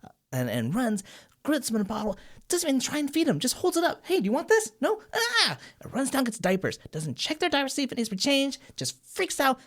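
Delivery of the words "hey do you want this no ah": very high frantic offer then a yelp; flustered babysitter. Retold or imitated. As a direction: wave the bottle in a panic.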